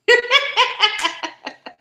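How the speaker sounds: high burst of laughter at herself; amused laugh. Real, not acted. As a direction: laugh at the memory. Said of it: "Remember when I used to be nervous?".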